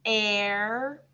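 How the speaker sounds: "Air" held long and level; slow demonstration of the sound "Air"; isolated sound. Shown as a demonstration. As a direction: stretch out the syllable "Air". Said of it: "switching sounds to the A".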